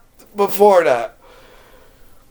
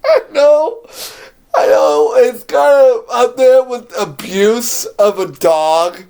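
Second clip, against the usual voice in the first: laughing; 7 semitones higher, 3 dB louder, slower than usual. Real, not acted. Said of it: "laughing at the dog scene".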